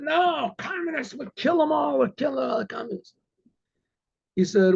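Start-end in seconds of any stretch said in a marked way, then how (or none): 0.0-3.1 high, strained mock shouting; rowdy mob cry, pitched way up; acted outrage. Cossacks answering about communists, acted outraged shouting.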